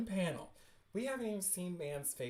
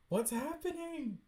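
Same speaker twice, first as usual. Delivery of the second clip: high-pitched question; 11 semitones higher than usual. Real, not acted. Reader puzzled by the comic's events, high-pitched.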